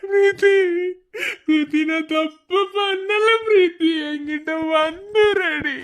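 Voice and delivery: slow, drawn-out tearful wail; sobbing. Acted. Man begging missing Preethi to come back, sobbing. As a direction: plead through sobs, stretching the words. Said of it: "டேய், அழாதடா".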